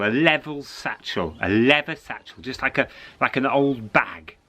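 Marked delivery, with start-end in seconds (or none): none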